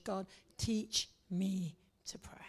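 soft, unvoiced plea; whispered prayer. Shown as a demonstration. Pastor modelling a hushed prayer to the congregation. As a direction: whisper the request to God.

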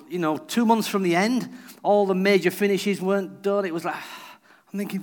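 ordinary delivery

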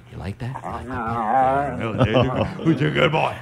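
talking to a dog. Real, not acted. Praising the dog.

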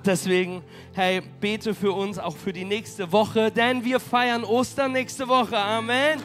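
usual speaking voice

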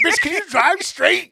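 a slow, high, loud mock complaint; retold yell. Grouchy passenger yelling at the driver over potholes, slow and high.

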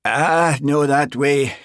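loud, high Highland call to stop; head her off in a broad Highland accent; flustered Highland accent. A narrator's reading. Sergeant steering constable from front door, flustered Highland accent. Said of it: "His Highland accent always grew more pronounced when he was flustered".

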